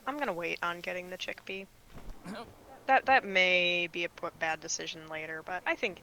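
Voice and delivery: ordinary talk, nothing marked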